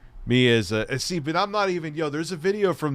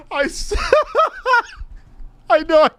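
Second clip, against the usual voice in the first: talking through laughter, high at first; real laughing. Real, not acted. Streamer cracking up at a clip he remembers, laughing. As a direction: burst out laughing and keep talking.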